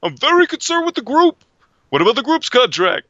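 high, loud, agitated shout; Keitel impression. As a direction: demand it like an anxious manager. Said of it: "It was like".

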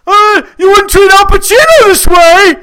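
high, loud, outraged shout; Harvey Keitel impression. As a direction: bellow it like an offended star. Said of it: "starts doing this dead on".

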